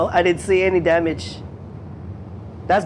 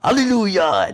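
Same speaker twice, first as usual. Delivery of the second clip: mock preacher; 2 semitones higher than usual. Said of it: "in a Presbyterian preacher's kind of voice".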